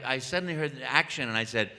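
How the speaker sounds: usual speaking voice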